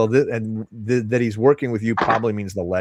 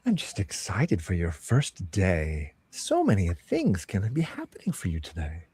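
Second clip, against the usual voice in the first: cheery voice in the ear. Acted. Plays the earring voice.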